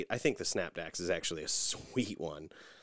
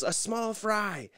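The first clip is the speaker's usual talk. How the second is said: higher, slow patient mock repeat; imitating Nikki; 13 semitones higher, much slower than usual. Wife repeats her fry order, mock-high and slow. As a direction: say it again slowly and plainly. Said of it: "so Nikki goes".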